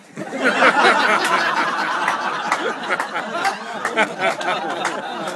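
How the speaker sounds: loud high laughter; loud laughing. Real, not acted. Comedian and crowd after the sheep joke, loud laughing. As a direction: crack up hard.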